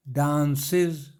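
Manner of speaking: Dances slowly in two beats, daan-ses; two-syllable split. Shown as a demonstration. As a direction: say dances stretched, each syllable distinct. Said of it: "वो अब दो syllable है".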